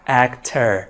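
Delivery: normal talking voice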